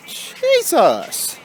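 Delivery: high, sudden "Jesus!"; yelped out in surprise; startled exclamation. Real, not acted. Caught off guard while walking, yelping.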